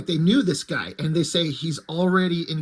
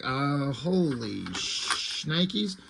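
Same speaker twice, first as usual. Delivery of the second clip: slow, drawn-out stunned reaction; mock shock; 2 semitones lower, 4 dB quieter, much slower than usual. Kevin sees the cube float by itself, imitated slow-motion shock. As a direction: stretch it out in hushed disbelief, hammed up. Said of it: "He's just kinda shocked".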